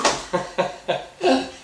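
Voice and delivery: short happy chuckle; delighted laugh. Real, not acted. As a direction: laugh with glee. Laughs at the find.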